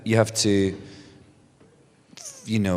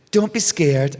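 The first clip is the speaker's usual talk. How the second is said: higher, louder coaxing; producer soothing kids; 7 semitones higher, 4 dB louder, slower than usual. Retold or imitated. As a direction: reassure frightened children. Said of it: "he said to the kids".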